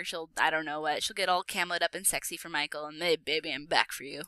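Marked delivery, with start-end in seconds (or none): 3.2-4.2 lightly voiced old man, a touch lower; a hint of an old man crooning to his love. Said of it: "in my old man voice".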